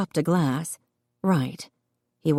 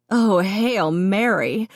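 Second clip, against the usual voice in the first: slow, higher wheeze, fighting back giggles; wheezed through laughter; 5 semitones higher, much slower than usual. A narrator's reading. Pregnant sister wheezing at her brother's tiny dog, breathless with laughter. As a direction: gasp it out mid-laugh. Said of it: "Colleen wheezed".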